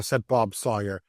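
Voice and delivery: ordinary delivery, nothing marked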